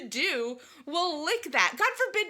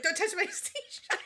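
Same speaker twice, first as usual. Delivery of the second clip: laughing quote; 7 semitones higher than usual. Retold or imitated.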